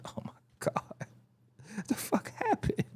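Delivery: hushed, breathless disbelief; aghast, nearly voiceless aside; baffled mock whisper. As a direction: whisper it as if stunned at yourself. Baffled at own sudden outburst.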